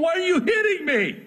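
high, hammed-up talking donkey pleading its case; indignant donkey voice. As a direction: play the donkey as an offended messenger, building to a shout. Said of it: "that was a little bit extreme".

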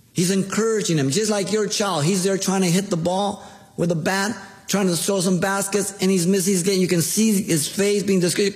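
impassioned preaching. Acted. Pastor illustrating obedience with a child's game, fervent preaching. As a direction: preach it to the pews with rising fervour.

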